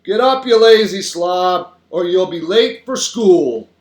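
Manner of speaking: slow, loud, scolding wake-up call; father's real voice. A narrator's reading. Father waking his lazy child for school, scolding. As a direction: holler it, dragging out the words. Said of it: "my father's real voice saying".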